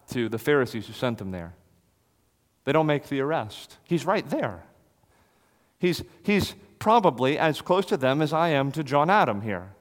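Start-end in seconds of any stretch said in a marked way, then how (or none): none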